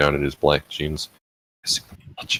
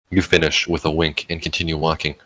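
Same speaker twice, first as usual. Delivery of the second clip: ordinary delivery, nothing marked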